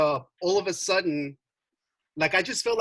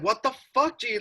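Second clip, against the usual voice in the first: higher, alarmed disbelief; freaking out; 7 semitones higher than usual. Retold or imitated. Narrator retelling his shock at the bleeding scratches, high alarmed blurt. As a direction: blurt it out seeing blood on his arms. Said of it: "I'm freaking out".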